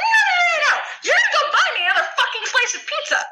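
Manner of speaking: screaming at the boy who knocked her pizza over, hammed-up; retold yelling.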